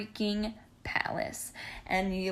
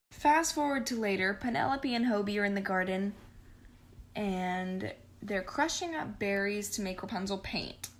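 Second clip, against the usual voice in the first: ordinary delivery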